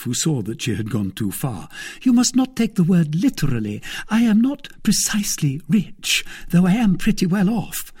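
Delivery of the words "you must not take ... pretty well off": high-pitched wife's voice; backtracking wife. Acted. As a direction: explain anxiously after going too far. Wife backs down.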